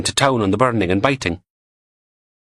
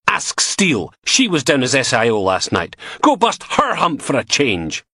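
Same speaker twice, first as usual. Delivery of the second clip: angry outburst; 11 semitones higher than usual. Acted. Logan fed up, shouting.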